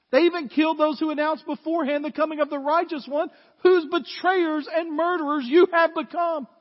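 forceful preaching. Acted. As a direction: drive the charge home to them.

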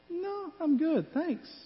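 high, slow, airy husband brushing it off; lightly voiced husband waving off the offer, drawn out; playful imitation of a husband saying no thanks; breezy husband brush-off.

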